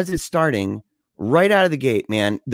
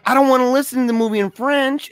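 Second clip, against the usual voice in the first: whiny; 11 semitones higher than usual. Retold or imitated. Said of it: "I'm like".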